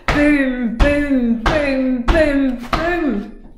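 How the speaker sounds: loud, high booms like giant steps; booming footsteps. A narrator's reading. Narrator booms the giant's footsteps. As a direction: boom out each footstep.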